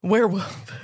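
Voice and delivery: "Werewolf" echoed without its L; dropped consonant. Shown as a demonstration. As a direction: swallow the L in "Werewolf". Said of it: "Lots of consonants just get dropped from words".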